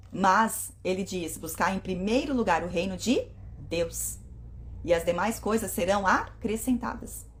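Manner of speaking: ordinary delivery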